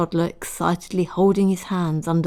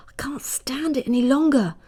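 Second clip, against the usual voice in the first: strained whisper under her breath; whispering to herself; 8 semitones higher than usual. A narrator's reading. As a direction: whisper it, at the end of her rope. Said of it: "she said to herself".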